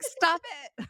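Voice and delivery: high, squealed mock protest; frustrated mock yell. Imitating a driver scolding the sun, frustrated mock yell. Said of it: "when he gets really frustrated with it".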